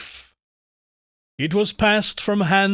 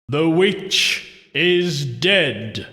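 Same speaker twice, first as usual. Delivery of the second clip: slow, drawn-out ritual intonation; solemn intoning; much slower than usual. A narrator's reading. Husband to the party in the dark, intoning. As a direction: intone it slowly, word by weighty word. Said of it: "intoned the husband".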